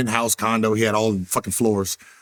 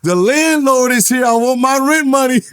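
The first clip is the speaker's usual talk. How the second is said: retold taunting yell; 11 semitones higher, 6 dB louder than usual. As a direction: re-enact yelling it out to taunt a teammate. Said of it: "I'll just yell".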